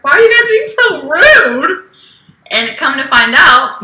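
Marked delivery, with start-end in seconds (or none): 0.0-1.9 high-pitched, whiny mock offence; retold indignation. Retelling being laughed at during a speech, high indignant protest. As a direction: replay the hurt protest way up high. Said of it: "and I was like".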